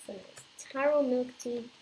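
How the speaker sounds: normal talking voice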